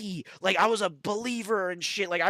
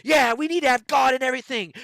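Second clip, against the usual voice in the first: mocking voters; 7 semitones higher, 8 dB louder than usual.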